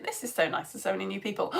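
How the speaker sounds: usual speaking voice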